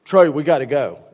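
put-on loud kid brother calling his big brother. Little brother calling Trey to leave, loud call. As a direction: call him to come home.

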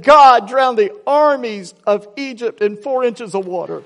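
high, loud, drawn-out mock rejoicing; excited proclamation. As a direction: proclaim it slowly like an astonished believer. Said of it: "what are you so excited about".

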